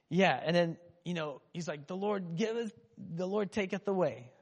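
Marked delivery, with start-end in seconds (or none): 1.9-4.3 put-on softer, old-fashioned scripture voice; King James Version. Preacher quoting Job, softer mock King James. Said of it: "Doing the King James Version".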